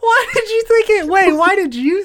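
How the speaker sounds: high laughing question. Real, not acted. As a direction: laugh through the words, up high. Laughing disbelief at friend.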